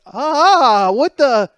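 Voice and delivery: high, loud yelp of pain; stabbed man yelling. Retold or imitated. Comedian acting his friend just stabbed, shocked yell.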